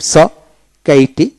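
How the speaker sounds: ordinary delivery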